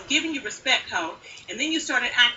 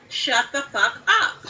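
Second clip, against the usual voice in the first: slow mocking scold; slower than usual. Real, not acted. Scolding someone on a livestream.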